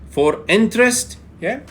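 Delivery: usual speaking voice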